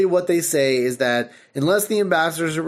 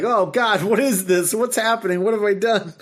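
panicked reporter; 5 semitones higher than usual. Retold or imitated. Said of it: "reporter is just like".

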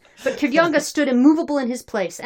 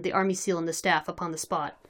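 usual speaking voice

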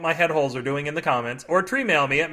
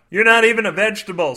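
louder, higher mock scream; 4 semitones higher, 6 dB louder than usual. A narrator's reading. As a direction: yell it out at the ketchup. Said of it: "screamed the asparagus".

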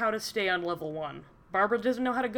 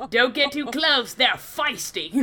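hammed-up Australian wildlife guide; Steve Irwin; 3 semitones higher, 4 dB louder than usual. Co-host warning about a feisty creature, mock Australian accent. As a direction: warn the viewers in an Australian accent. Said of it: "like having Steve Irwin drive me".

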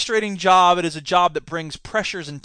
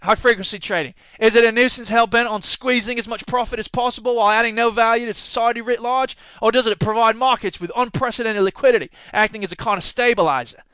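mock Australian news reading; Australian accent; 3 semitones higher than usual. Shown as a demonstration. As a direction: read the article as an Australian. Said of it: "should I read it in Australian accent?".

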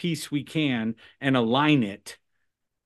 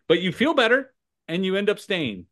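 ordinary talk, nothing marked

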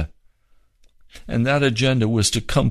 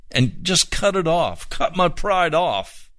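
impassioned plea; 5 semitones higher than usual. Real, not acted.

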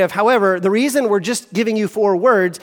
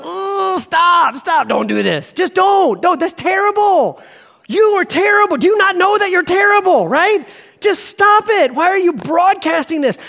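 cringing plea; 8 semitones higher than usual. Retold or imitated. Cringing viewer begs singer stop.

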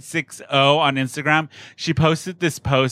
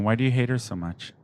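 ordinary delivery

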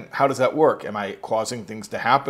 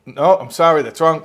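loud, a bit higher, rising mock verdict; stern experimenter; 5 semitones higher, 9 dB louder than usual. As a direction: announce the wrong answer like a quizmaster. Experimenter voiced again.

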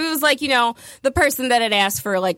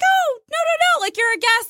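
sweet hostess protest. Retold or imitated.

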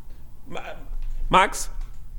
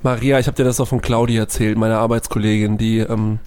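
playing Bernd; 9 semitones lower than usual. Spielt Bernd.

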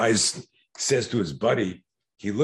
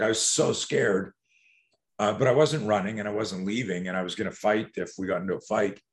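normal talking voice

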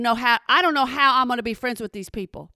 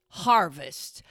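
mocking; 8 semitones lower, 4 dB quieter than usual. Mocking the sign's word.

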